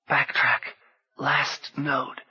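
high, hoarse dry whisper. A narrator's reading. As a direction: rasp it out dryly. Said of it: "was only a dry whisper".

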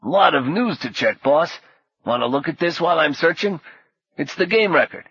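busy sidekick with a Brooklyn rasp; raspy Brooklyn accent. A narrator's reading. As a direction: report to the boss in raspy Brooklynese. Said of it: "said in a raspy Brooklyn accent".

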